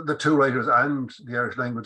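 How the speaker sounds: ordinary talk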